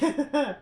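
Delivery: short high laugh; high burst of laughter. Real, not acted. Host laughing at own joke, high laugh.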